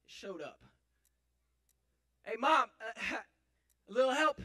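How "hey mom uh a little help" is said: loud, raised call for help. Acted. Stuck son to his mom, calling for help. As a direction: holler to someone off in another room.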